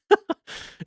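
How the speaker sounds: short airy laugh; a quick unvoiced chuckle; breathy laugh. Real, not acted. Laugh after exclamation.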